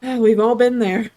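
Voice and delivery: loud laughing aside. Real, not acted. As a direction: laugh through the words. Laughing at dog line.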